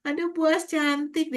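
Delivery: lightly voiced small child, slow and high; imitating a small child. Child greeting teacher Bu As, mild childlike voice. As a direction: coo it like a little kid flattering teacher. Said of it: "Nanti dia datang".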